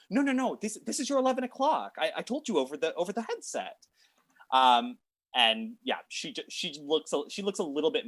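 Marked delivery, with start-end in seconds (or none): 0.1-3.8 high, fast, flustered protest; flustered receptionist. Acted. Receptionist pleading with his boss, high and fast. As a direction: insist in a high rushed voice. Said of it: "And he goes".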